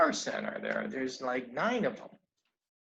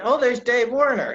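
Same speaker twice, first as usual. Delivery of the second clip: ordinary talk, nothing marked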